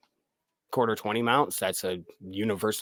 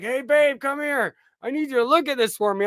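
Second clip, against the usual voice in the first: high, fast call to his partner; calling his wife; 14 semitones higher, 3 dB louder than usual. Retold or imitated. Calling his wife over to check the screen, high and hurried. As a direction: sing out to babe, hurried. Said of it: "I was like".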